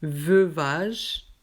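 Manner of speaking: usual speaking voice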